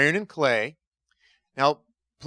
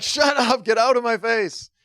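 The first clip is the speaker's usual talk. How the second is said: high-pitched mock anger; exasperated retort; 8 semitones higher than usual. Sufferer answering meddling advisers, mock-exasperated. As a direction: fend them off in acted-out irritation.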